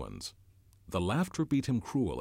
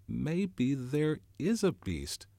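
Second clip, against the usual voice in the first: slow, higher-pitched, tentative suggestion; hesitant and drawn out, pitched up; 7 semitones higher, much slower than usual. A narrator's reading. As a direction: draw it out as you venture the idea. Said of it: "he said hesitantly".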